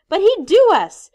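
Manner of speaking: high, loud fearful cry; frightened shout. Acted. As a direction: shout it in panic. Boy fears the boar.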